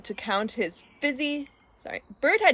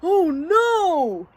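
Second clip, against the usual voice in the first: high, loud mock dismay; gasping in horror; 7 semitones higher, 6 dB louder than usual. A narrator's reading. Ernie pretends shock at Bert's tongue, high and horrified. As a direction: swell into a horrified cry. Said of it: "Ernie gasped".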